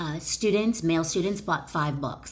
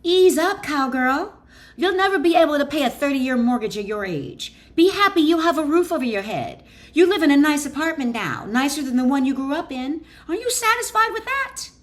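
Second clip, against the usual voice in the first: higher, louder, fast mocking inner critic; scolding inner voice; 8 semitones higher, 6 dB louder than usual. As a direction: needle her like a bossy know-it-all. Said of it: "your principal mind, might reason".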